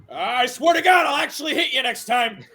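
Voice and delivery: hammed-up warning after a missed shot; mock angry threat. Character who fired and missed threatens his target, mock angry yell.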